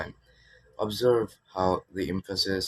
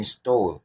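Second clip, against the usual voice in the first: "stole" punched louder; stress on "stole"; 6 dB louder than usual. Shown as a demonstration. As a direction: hit the word "stole".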